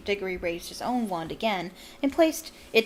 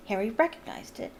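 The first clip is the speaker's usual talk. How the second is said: usual speaking voice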